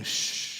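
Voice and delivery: whispered shh; soft shush. Shown as a demonstration. Pastor showing the hush to a baby. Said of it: "the act of saying".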